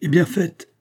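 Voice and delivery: high, sharp exclaimed order; impatient command. A narrator's reading. Angry king orders the arrest. (ordre impatient lancé vivement)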